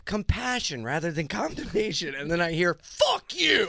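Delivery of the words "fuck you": high-pitched sudden yell; retold scream. Friend retelling her outburst at a stranger, high mock scream. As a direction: imitate someone screaming abuse down the street. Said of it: "You just scream back".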